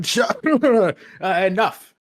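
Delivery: play-acted classroom scolding with a laugh breaking in; mock stern teacher.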